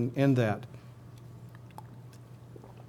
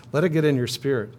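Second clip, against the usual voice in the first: ordinary talk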